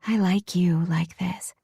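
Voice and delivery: whispered with a smile; soft smiling whisper. Acted. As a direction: hush it, fond and smiling. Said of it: "her voice is soft and I can hear the smile in it".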